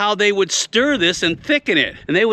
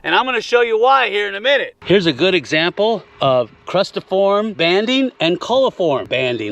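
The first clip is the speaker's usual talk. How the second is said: normal talking voice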